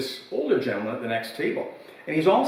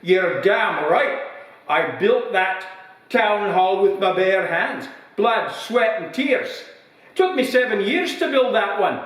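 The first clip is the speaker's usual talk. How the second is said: put-on slow proud old man; 7 semitones higher, slower than usual. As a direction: savour every word of the brag. Old man in pub joke.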